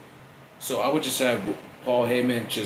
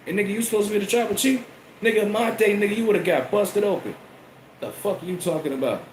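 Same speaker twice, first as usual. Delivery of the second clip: higher, fast scolding rant; higher fast mock Rock tirade; 9 semitones higher than usual. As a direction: chew him out like the old head. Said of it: "I'd say".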